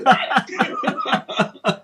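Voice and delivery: very high, laughing squeak of disbelief; retold shocked reaction. Telling the dinner story to the host, high-pitched mock shock.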